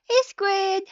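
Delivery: high, sweet mock-cheerful; overly nice customer-service tone; sugary fake-friendly voice. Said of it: "They said".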